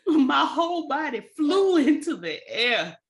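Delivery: loud, high, laughing retelling; breaking up mid-story; laughing while telling. Real, not acted. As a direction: tell it through laughter, voice rising. Laughing through fall story.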